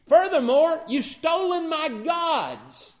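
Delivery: Laban's loud charge of theft; indignant accusation. Retold or imitated. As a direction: hurl the final accusation.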